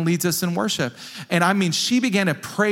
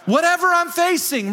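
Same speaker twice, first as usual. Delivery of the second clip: fervent cry; 11 semitones higher, 4 dB louder than usual. Real, not acted. Preacher's passionate climax.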